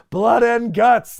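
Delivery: hammed-up catchphrase, big and slow; Regal's catchphrase. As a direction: bellow "Blood and guts" like an announcer, drawn out. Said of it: "he says the thing".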